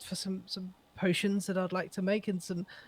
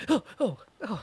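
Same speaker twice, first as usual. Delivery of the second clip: scared gasp; 4 semitones higher than usual. Acted. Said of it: "I look over at the wall and go".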